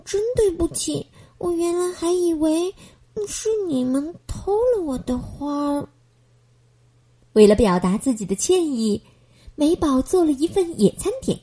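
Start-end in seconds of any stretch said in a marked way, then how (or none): 0.0-5.9 soft apology. Acted. Apologetic girl voice.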